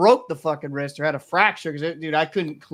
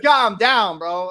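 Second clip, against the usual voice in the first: louder, higher trash talk at a try-hard; chirping taunt; 4 semitones higher, 6 dB louder, much slower than usual. Retold or imitated. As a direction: chirp him hard on the court.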